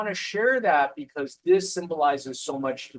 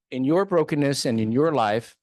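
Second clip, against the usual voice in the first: louder, driving the repeated phrase home; emphatic repeat; 8 dB louder than usual. Real, not acted. Urging struggling listeners to believe, louder emphatic repeat. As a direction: punch the repeat out to the room.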